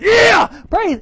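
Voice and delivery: very high, loud shouted praise; joyful shout. Acted. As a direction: cry out in wild celebration. David dancing, shouting praise.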